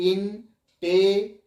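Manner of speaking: Intelligent in four separate slow beats; slow syllable breakdown. Shown as a demonstration. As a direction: pause between ইন, টে, লি, gent in intelligent. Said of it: "চারবারে হচ্ছে কি না". (Intelligent চার ভাগে ধীরে ধীরে)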